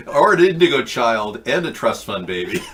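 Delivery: giggling through the words; laughing. Real, not acted. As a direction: laugh as you say it.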